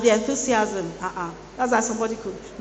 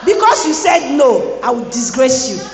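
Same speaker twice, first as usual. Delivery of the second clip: loud, higher playful threat; mock threatening shout; 4 semitones higher, 8 dB louder than usual. Preacher teases the crowd, mock threat shout.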